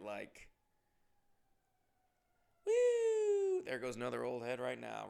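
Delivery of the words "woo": long, loud, high-pitched woo; whistle-like mock whoosh upward; high whoop. Joking that another old head departs, high whoop.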